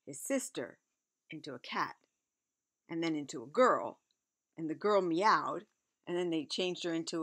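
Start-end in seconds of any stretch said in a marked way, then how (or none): none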